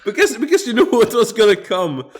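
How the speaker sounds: high, quick, laughing speech. Real, not acted. Explaining they knew what was gonna come, laughing. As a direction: rush the explanation out while laughing. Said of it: "I mean, I was already laughing".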